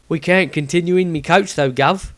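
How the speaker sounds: a humble Cockney driver; Cockney coachman. Acted. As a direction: Samuel the coachman, in light Cockney. Samuel coachman voice.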